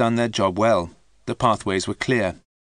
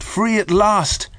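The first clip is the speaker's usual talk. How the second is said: high, slow and louder cry of freedom; joyful relief; 11 semitones higher, 5 dB louder, slower than usual. A narrator's reading. Man sees the gate opening, slow high joyful relief. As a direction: savour it, free at last. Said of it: "Humphreys felt happy".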